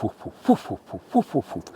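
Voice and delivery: whispered rhythmic whoof sounds, in threes; imitated helicopter whoof. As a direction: breathe out the blade thumps like a helicopter overhead. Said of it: "Sounds like helicopter blades".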